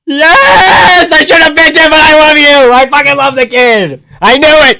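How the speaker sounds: very high, loud screamed cheer; ecstatic celebration shout. Real, not acted. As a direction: yell it out in triumph. Celebrates the fight result live.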